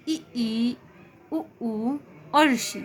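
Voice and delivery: "इ, ई, उ, ऊ" said slowly with the mouth nearly shut; closed vowel demo. Shown as a demonstration. Teacher demonstrating closed vowels, slow with mouth nearly shut. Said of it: "मुंह बिल्कुल बंद ही है".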